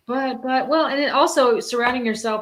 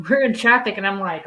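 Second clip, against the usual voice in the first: ordinary delivery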